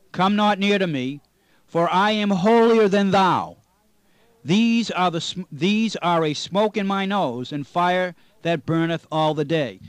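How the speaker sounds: loud, slow, solemn Bible reading; scripture reading. Acted. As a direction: read the King James verses out like from a pulpit.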